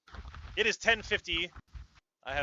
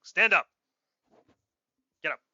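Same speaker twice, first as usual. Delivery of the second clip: loud, high shouted commands; guard yelling at a sleeper; barked orders; 8 semitones higher, 9 dB louder than usual. Acted. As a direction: bark them awake.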